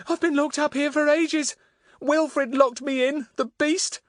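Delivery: put-on high, upset voice of a trapped girl; young girl voice. A narrator's reading.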